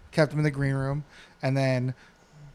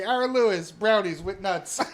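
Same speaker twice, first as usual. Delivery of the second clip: laughing; 6 semitones higher than usual. Retold or imitated. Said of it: "I would just say".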